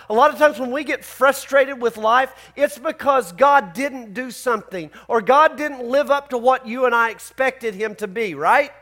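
ordinary talk, nothing marked

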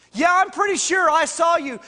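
loud, high, pressing accusation; accusing. Retold or imitated. Accuser pressing Peter by fire.

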